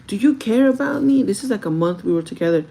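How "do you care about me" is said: loud, high-pitched plea; raised-voice retold challenge; upset boyfriend. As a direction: imitate a boyfriend demanding an answer. Acts boyfriend's upset demand.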